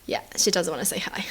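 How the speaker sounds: soft unvoiced whisper about the shy pet. Real, not acted. Whispering to viewers beside a shy pet. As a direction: whisper so as not to startle her.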